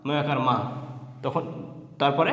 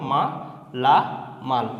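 মা, লা, মাল with pauses between; three separate beats, মা, লা, মাল; broken into syllables; 3 semitones lower than usual. Shown as a demonstration. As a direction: sound out each piece: মা, লা, মাল. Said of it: "সেটিকে ভেঙে ভেঙে পড়তে হবে".

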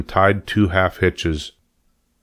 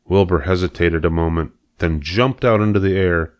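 ordinary delivery

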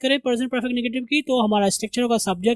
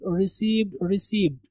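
ordinary delivery

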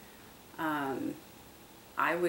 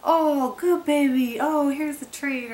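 put-on much higher and louder, gushing baby talk; obnoxious praise; 10 semitones higher, 8 dB louder than usual. Handing the puppy a treat, gushing high praise. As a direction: lay the praise on thick. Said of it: "totally overdo the praise".

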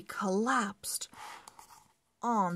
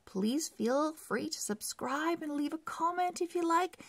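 cheerful outro; 9 semitones higher, much faster than usual. Real, not acted. Channel outro.

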